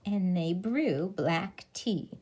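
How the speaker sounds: lively, expressive storybook reading; reading to children. A narrator's reading. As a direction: read it like a bedtime picture book to little kids. Teacher reads picture book aloud.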